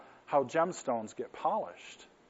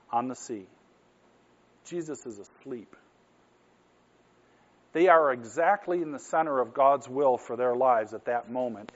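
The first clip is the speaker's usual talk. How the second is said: ordinary talk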